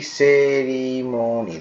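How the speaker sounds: teacher's slow, split-up সেরিমনি; syllable by syllable. Shown as a demonstration. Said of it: "দেখো কিভাবে করছে".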